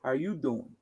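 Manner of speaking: soft, smooth Joey flirt; Joey's pickup line. Shown as a demonstration. Joey softly tries the Friends line again. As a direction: murmur it like a TV charmer. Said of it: "If I was on Friends".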